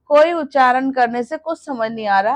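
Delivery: ordinary talk, nothing marked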